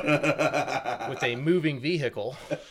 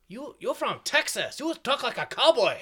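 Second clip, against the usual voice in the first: high, swooping Filipino accent impression; high-pitched mock Filipino accent; imitated Filipino speaker; 10 semitones higher than usual. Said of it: "like, all the Filipinos or whatever".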